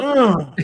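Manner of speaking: sharp, loud grunting Ugh; tennis grunt. Retold or imitated. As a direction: grunt like a tennis player hitting. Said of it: "he lets out the tennis grunt too".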